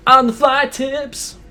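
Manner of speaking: slow, high hyped segment title; announcer voice. Acted. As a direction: announce it like a show bumper.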